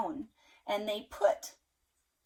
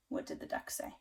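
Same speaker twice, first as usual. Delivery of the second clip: plain reading voice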